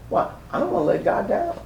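ordinary talk, nothing marked

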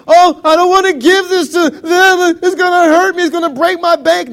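loud, high, hammed-up complaint; grudging wail. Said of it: "Not grudgingly".

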